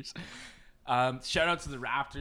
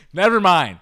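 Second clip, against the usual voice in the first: laughing; 8 semitones higher, 17 dB louder than usual. Real, not acted. Still laughing at mistake.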